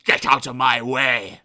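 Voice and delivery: slow, loud threat; snapped. Acted. Mister Grumpy snapping at Mister Happy, slow and loud. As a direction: growl him aside. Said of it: "snapped Mister Grumpy".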